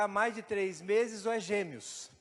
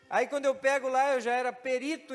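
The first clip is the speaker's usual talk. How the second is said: excited build-up; 7 semitones higher, 9 dB louder than usual. Real, not acted. Climax of testimony, loud.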